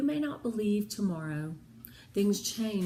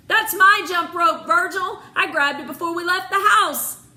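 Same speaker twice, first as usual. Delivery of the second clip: calling down, loud and higher, proud; 7 semitones higher, 9 dB louder than usual. A narrator's reading. Jen calling down into the well, proud and loud. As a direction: show off your clever idea. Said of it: "Jen called down proudly".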